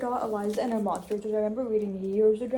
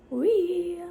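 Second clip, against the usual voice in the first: gleeful squeal; 9 semitones higher, 5 dB louder than usual. Real, not acted. Happy squeal walking.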